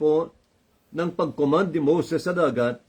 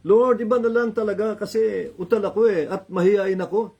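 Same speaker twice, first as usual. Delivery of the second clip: mock whining; 6 semitones higher, 3 dB louder than usual. Moses whining, mocked by preacher.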